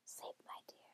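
very soft, soothing whisper; hushed, barely audible. A narrator's reading. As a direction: whisper someone to sleep. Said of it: "Hansel whispered".

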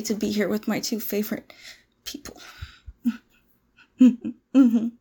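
ordinary delivery

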